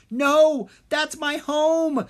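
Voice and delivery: tearful loud wail; yelling in despair. Acted. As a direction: scream as he watches his home fall. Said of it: "Drake yelled".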